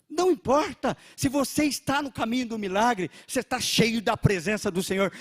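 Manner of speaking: loud, driving sermon voice; fervent preaching. Real, not acted. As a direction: press the church with fervor. Preaching at full force. (pregação fervorosa e em voz alta)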